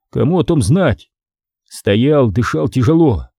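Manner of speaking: ordinary delivery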